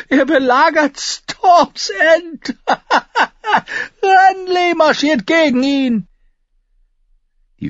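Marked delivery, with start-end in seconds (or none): 0.1-6.1 slow, high, loud, broken by laughs; laughing hysterically. Acted. Tyrion telling how Renly marches on Stannis, hysterical laughter. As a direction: crack up while delivering the news.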